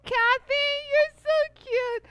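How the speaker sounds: sing-song high cooing, stretched out; cutesy coo. Real, not acted. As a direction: coo at her like a sweet little thing. Cooing at a viewer comment.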